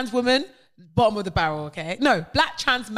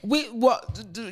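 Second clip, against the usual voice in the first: usual speaking voice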